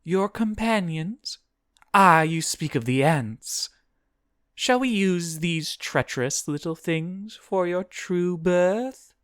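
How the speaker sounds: high-pitched demon, scheming. A narrator's reading. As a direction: propose a dark bargain in a high creature voice. Voices demon plotting with Guinevere.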